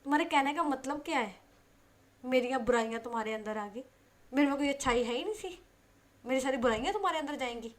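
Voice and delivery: loud, indignant scolding; angry retort. Acted. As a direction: hurl it back at her, offended. Stares and snaps back. (गुस्से में डाँटना)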